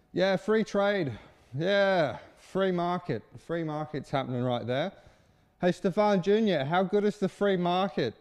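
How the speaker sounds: lazy gloating boss calling down to the field; smug lounging landlord. Acted. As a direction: lord it over him from the porch. Smug Bill lounging on porch.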